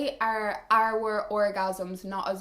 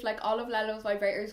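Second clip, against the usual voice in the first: usual speaking voice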